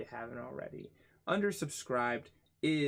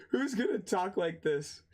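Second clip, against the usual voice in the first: higher, laughing incredulity; real laughter; 6 semitones higher, 3 dB louder than usual. Real, not acted. Streamer cracking up at absurd jargon, laughing. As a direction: crack up while asking it.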